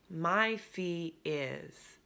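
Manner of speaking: My fee is said very slowly with a falling end; flat statement. Shown as a demonstration. Coach demonstrating a price said as a firm statement. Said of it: "And it's a statement".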